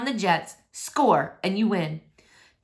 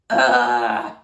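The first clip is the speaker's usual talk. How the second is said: groan; 9 semitones lower, 6 dB louder than usual. Acted. Groan at homework assignment.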